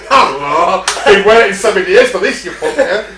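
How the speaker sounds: loud, high gleeful tiger yell; mock tiger shout. Tiger taunting its trainer after years caged, loud gleeful yell. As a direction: bellow it like the tiger's revenge at last.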